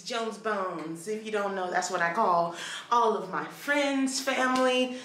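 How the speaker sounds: ordinary talk, nothing marked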